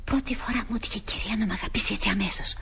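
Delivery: hushed, whispery aside to herself; whispered aside. Acted. Maid alone, marvelling at the lady's sudden affection, whispered aside. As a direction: whisper it privately, half to the audience.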